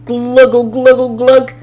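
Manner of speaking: loud bubbling gluggle; gluggling underwater. A narrator's reading. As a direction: gargle it like water in the mouth. Sinking in the pond.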